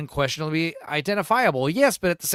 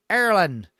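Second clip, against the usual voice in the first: usual speaking voice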